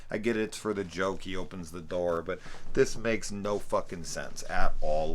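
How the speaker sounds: usual speaking voice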